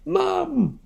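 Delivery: a storybook yelp with pants on fire; hollering. Acted. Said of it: "Harold hollered".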